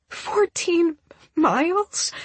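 high, slow, questioning cry; tearful disbelief. A narrator's reading. Crying woman stunned at how far he walked, tearful. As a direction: ask it stunned, through tears.

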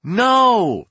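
crowd's high, loud shout back. A narrator's reading. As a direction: shout the answer, high and long. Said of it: "they shouted".

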